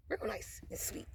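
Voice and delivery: soft whispered sweetness. Shown as a demonstration. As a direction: breathe it sweetly, barely voiced. Showing how sweet she starts off.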